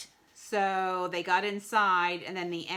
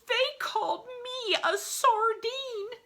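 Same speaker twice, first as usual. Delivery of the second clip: high, slow indignant whine; offended piranha; 3 dB louder than usual. A narrator's reading. Mister Piranha sulks at being misnamed, high indignation. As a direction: draw it out in squeaky outrage.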